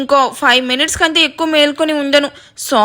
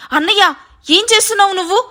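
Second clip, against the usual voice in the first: high, quick panicked question; alarmed cry; 8 semitones higher, faster than usual. A narrator's reading. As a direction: rush it out in alarm. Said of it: "అంటూ కంగారుగా".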